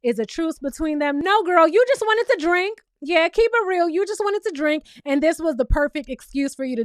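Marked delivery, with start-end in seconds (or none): 1.2-4.8 loud call-out. Real, not acted. Calling Britt out.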